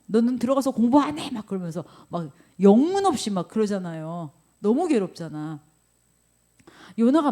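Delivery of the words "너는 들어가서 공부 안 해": mock scolding mom. Mother yelling at child.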